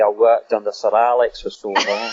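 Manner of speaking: conversational Scottish accent demo. Shown as a demonstration. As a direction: talk like a Scot. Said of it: "my Scottish is my best one".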